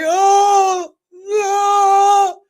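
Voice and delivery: loud, very high drawn-out wail; mock wail. Acting out the energy she is in, mock wail. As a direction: cry out in exaggerated dismay, high and loud.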